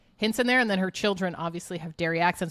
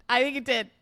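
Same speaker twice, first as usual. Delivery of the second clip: a bit higher, insistent repeat; more emphatic; 5 semitones higher than usual. Shown as a demonstration. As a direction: press the point, teasing.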